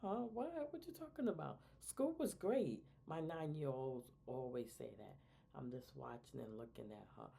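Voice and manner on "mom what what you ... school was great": put-on high, whiny kid voice brushing off mom; mock the kid's eye-roll tone; imitating bored kid. A kid brushing off mom's questions about school, high and whiny. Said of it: "My kids be like".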